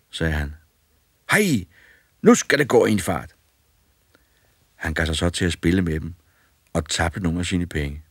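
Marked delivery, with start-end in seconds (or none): 1.3-3.4 put-on boy whoops, much higher and louder; gleeful cry to start the game; excited boy. A narrator's reading. Boy eager to play, excited and loud.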